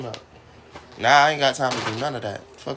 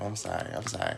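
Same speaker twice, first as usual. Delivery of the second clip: quiet apology; 9 dB quieter than usual. Real, not acted. Quiet apology after rant.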